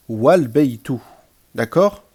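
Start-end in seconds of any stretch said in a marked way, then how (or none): 0.1-1.0 loud "Wal baytu" joined with no break; "Wal baytu" as one word, the alif silent; liaison. Shown as a demonstration. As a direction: slide "wa" straight into the l, "Wal baytu". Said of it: "si je fais la liaison".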